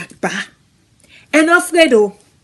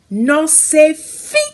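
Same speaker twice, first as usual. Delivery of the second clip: ordinary delivery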